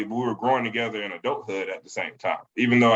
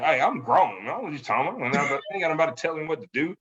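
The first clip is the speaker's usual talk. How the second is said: put-on higher, quick, know-it-all young adult brushing off parents; mock-defiant young grown-up, pitched up; cocky young adult; 5 semitones higher, faster than usual. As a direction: toss it off like a teen who thinks he's grown. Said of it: "a lot of young adults get".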